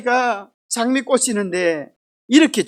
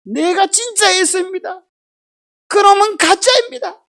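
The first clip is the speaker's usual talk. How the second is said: higher, louder outraged protest; indignant Esau; 7 semitones higher, 4 dB louder than usual. Retold or imitated. Esau to his father, indignantly protesting. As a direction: insist he is the real one. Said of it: "에서가 뭐라 그래요?".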